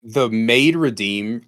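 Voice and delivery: normal talking voice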